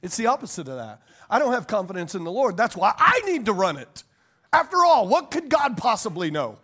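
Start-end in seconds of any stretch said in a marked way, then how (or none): 4.5-6.6 loud mock-incredulous jab; lightly voiced sarcasm, then dry. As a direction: scoff at the idea as if God knows nothing. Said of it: "That was sarcasm".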